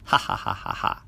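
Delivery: whispered sly chuckle; silent laugh. Acted. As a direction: laugh under your breath. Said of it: "He laughed inside his head".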